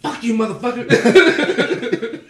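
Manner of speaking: slow, high, loud cussing through laughter; startled friend's retort, laughing; laughing mock curse. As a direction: curse him out while cracking up.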